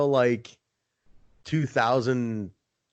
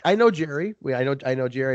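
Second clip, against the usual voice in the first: normal talking voice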